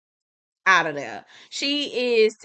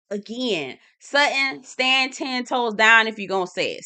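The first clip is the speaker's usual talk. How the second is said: normal talking voice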